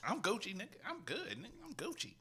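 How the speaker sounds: high, hushed mock reply; acted slang example. Host demonstrating how Gucci means okay, acted example. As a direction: act out a casual reply, soft and high.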